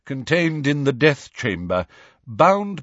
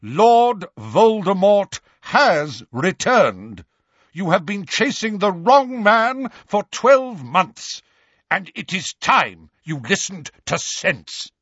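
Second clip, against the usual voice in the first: raised, forceful, deliberate rebuke; thunderous; 7 semitones higher, 4 dB louder than usual. A narrator's reading. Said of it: "in a thunderous voice".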